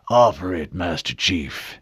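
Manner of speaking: slow, lightly rough elder; a hint of a gruff old official; scratchy old man voice. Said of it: "the same scratchy voice said".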